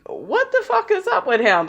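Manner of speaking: high-pitched incredulous question; mock bafflement. Onlookers staring at the man who threw ice, baffled.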